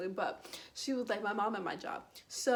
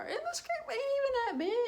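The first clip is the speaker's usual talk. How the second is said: squeaky protest; 3 dB louder than usual. Real, not acted. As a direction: squeak it out in mock disbelief. Protesting the scrape was small.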